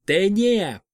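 loud ringing summons; loud shout. A narrator's reading. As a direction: call out the fire word loudly. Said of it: "громко крикнула".

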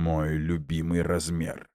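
quiet slow gritted-teeth words; through gritted teeth. Acted. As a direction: grit your teeth and say it. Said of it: "скрипнул зубами Андрей".

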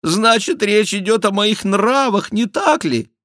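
high, loud mock-penitent whine; whining, high-pitched question; tearful tone. A narrator's reading. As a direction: keep up the put-on tearfulness. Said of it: "слезливым тоном отозвался Шико".

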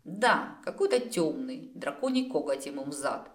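plain reading voice

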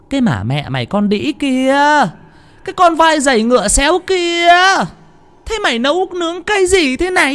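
very high shrill screaming abuse; furious screeching. A narrator's reading. Mistress Lương screaming at the cook over the food, furious. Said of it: "nghe tiếng quát choa choa lên".

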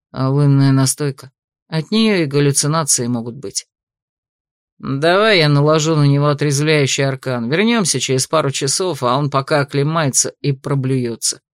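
a hint of a stern investigator, low. A narrator's reading. Investigators talking, lowered voices.

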